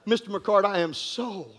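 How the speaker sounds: a hint of a sorry policeman; quiet sympathetic officer. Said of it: "he said".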